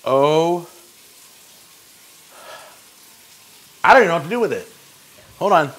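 strained and pained from chili heat; mouth burning from spicy food. Real, not acted. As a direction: talk through a burning mouth. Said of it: "it's burning my, my mouth out big time".

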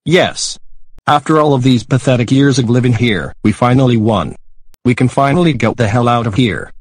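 loud joyful celebration; excited shouting. Acted. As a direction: cheer it out loudly. Family wins the lottery.

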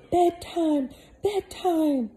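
loud, high panicked cry of the crowd; terrified screaming. Acted. Palace people fleeing the bell, loud high terrified screams. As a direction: shriek it like a frightened crowd. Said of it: "the people screamed".

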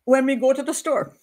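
animated imitation of a Jamaican storyteller; Jamaican accent. Shown as a demonstration. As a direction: build up the tale with a Jamaican lilt. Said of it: "But a Jamaican will say".

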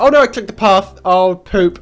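loud, high alarm; dismayed outburst. Real, not acted. Gamer clicking the path by mistake, loud dismay. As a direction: cry out at your own slip.

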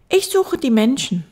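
put-on high, loud child voice; little prince. Little prince answering the fox, high child voice.

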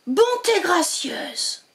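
slow high astonished gasp of words; astonished exclaim. Acted. Witch discovering a baby dragon, slow astonished exclaim. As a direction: draw it out in amazement. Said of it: "s'écria-t-elle".

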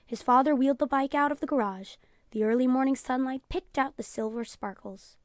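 plain reading voice

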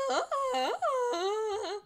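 high loud mock warble; mock Sim singing. Streamer mocking a level one Sim singer, high loud warble. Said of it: "it's like".

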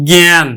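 জ্ঞান said as gæn, open æ vowel; জ্ঞান spoken as gæn, the a like æ; pronounce it right. Shown as a demonstration. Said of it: "আমরা কিন্তু এইভাবে উচ্চারণ করি জ্ঞান".

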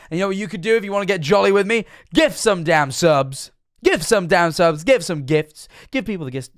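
loud, jolly plug for subs; being loud. Real, not acted. Streamer hyping chat to gift subs, loud and jolly. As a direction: push the gift-subs ask, loud and eager. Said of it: "Oh, I'm being loud".

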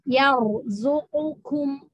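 slow "Yarzuqukum", ro thick with rounded lips; heavy ro. Shown as a demonstration. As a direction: recite "Yarzuqukum" slowly, the ro full. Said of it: "bacanya bukan yar".

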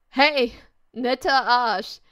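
loud, cheeky call, the second part drawn out slowly; calling after her. Acted. Boy calls after the girl on the stairs, loud and cheeky. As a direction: shout it across the stairwell with a dirty grin. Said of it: "rief er mir hinterher".

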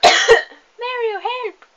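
high, loud damsel calling for Mario; mock princess cry. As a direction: squeal for rescue like a captured princess. Imitating Peach calling Mario.

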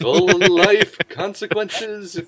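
slow, high, loud mock-dramatic line; drawn-out hammy movie narrator; dramatic parody quote. Quoting a parody video.

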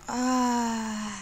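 a short startled cry; frightened cry. Real, not acted. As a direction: yelp in fear. Scared in the dark. (petit cri de peur)

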